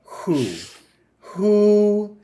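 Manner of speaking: stunned disbelief, calling out; incredulous. Retold or imitated. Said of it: "I'm like".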